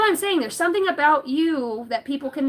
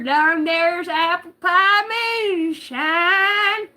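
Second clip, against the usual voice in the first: mock hillbilly; 6 semitones higher, 5 dB louder, much slower than usual. Hillbilly moonshine bit.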